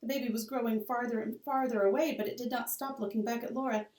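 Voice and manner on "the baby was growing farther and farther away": ordinary delivery, nothing marked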